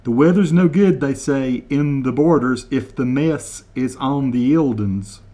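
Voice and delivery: slow, deliberate over-enunciation of each word; slow mock translation. Shown as a demonstration. Translating Kevin's Scottish for viewers, slow and deliberate. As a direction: spell it out slowly for Americans, deadpan. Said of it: "translate word for word".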